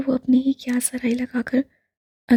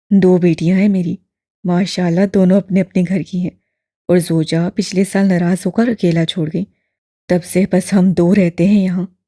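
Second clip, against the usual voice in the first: put-on low, louder elderly man, gently probing; lower, fuller old gentleman; old imam voice; 6 semitones lower, 6 dB louder than usual. A narrator's reading. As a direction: voice the old man, kindly and curious. Old man tells family.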